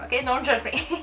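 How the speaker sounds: ordinary delivery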